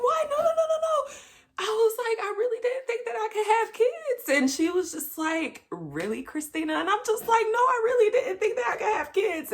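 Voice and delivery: high, tearful retelling; about to cry. Real, not acted. Woman recalling her pregnancy news, high and near tears. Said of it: "I feel like I'm about to cry right now".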